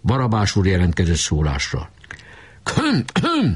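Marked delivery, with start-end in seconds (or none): 2.6-3.5 high, pompous throat clearing. A narrator's reading. Barabás clearing his throat before his speech. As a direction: clear your throat importantly. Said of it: "köszörülte a torkát".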